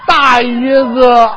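slow, loud 大姨子 with a laugh; drawn-out punchline. Pretend. Topping the straight man's setup, slow loud laughing punchline. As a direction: stretch 大姨子 out like a crowd-pleaser.

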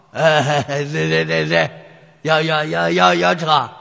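lightly voiced old man, stuttering yes yes; a hint of a frail grandpa stammering out an answer. Old passenger stammering to the taxi driver, lightly voiced.

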